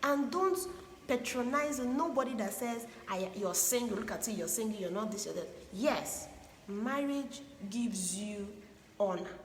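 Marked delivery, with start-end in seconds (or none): none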